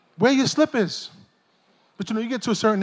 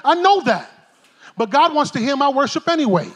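ordinary talk

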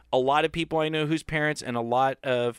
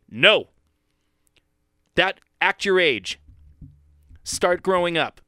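firm parental reprimand, a touch raised; mock stern parent; 4 semitones higher, 4 dB louder than usual. Fed-up parents to their child, stern imitated scolding. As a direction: lay it down in separate commands.

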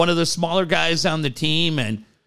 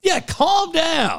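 higher, slow calm down; drawn-out higher calm down; mock soothing; 12 semitones higher, slower than usual. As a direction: hold off the eager son, slowly.

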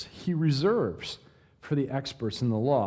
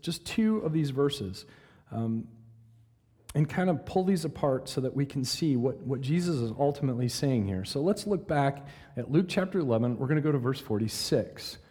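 usual speaking voice

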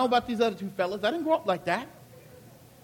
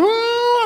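high ooh; 11 semitones higher, 6 dB louder than usual. Retold or imitated. Driving backwards on the freeway.

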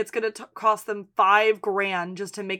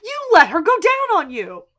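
thrilled gasp; 12 semitones higher, 10 dB louder than usual. Retold or imitated. Husband reacting to wife's confession, loud and thrilled. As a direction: gasp it out, shocked and eager. Kev excited about her confession.

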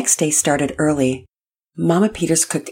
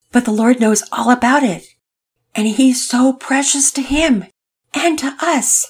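emotional; 9 semitones higher, 5 dB louder than usual. Acted. Dad emotional.

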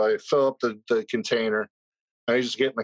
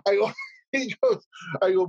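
ordinary talk, nothing marked

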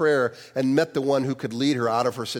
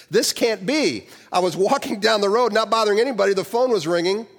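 higher, louder, rattled protest; flustered surprise; 8 semitones higher, 3 dB louder than usual. A narrator's reading. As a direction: blurt it out in disbelief. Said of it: "control of my stammering from the surprise".

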